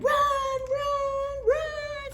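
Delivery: high frightened cry; panicked cry. Acted. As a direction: call them to flee. People in tent shout.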